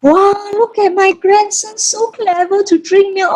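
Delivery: high, slow, gushing grandma; doting grandma. Retold or imitated. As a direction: coo over the grandson like a proud grandmother. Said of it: "my mother was standing outside there saying".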